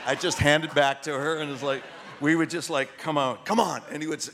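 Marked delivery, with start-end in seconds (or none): none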